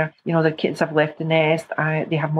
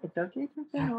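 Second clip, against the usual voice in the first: much softer repeat to oneself; soft self-reminder; 5 semitones higher, 12 dB quieter than usual. Real, not acted. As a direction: murmur it as a reminder.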